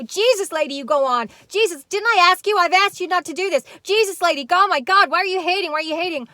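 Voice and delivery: high, loud hammed-up exasperation; mock exasperated rant. Imitating the other woman attacking the caller, high and loud. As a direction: shriek it like an exasperated heckler. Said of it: "all the other woman could do was go".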